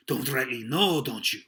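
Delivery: loud, mocking repetition; scornful echo. Silver repeats Morgan's excuse, loudly scornful. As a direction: throw his words back at him hard.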